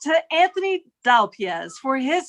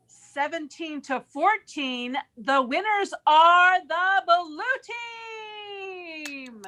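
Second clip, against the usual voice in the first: announcer's big reveal with long final words; winner announcement; 4 semitones higher than usual. Real, not acted. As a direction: build up, then stretch the winning name.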